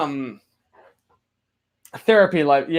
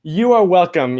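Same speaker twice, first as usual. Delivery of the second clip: ordinary talk, nothing marked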